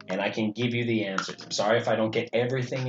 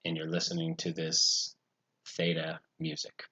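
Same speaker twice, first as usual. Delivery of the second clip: guided meditation; 4 semitones lower, 6 dB quieter than usual. Acted. Meditation guide settles listeners in before starting, soft and low. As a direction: speak quietly and unhurried, with spacious pauses.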